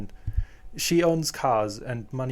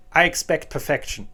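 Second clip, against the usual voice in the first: a firm, louder demand; very serious; 4 semitones higher, 6 dB louder than usual. A narrator's reading. Mother demanding perfection from her new daughter, dead serious. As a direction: lay down the rule with no give. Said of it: "very seriously".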